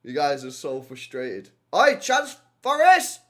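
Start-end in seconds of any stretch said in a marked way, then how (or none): 1.8-3.2 high, loud, slow cry at a chance; excited shout. Real, not acted. Streamer watching match, excited shout. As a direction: call out the chance.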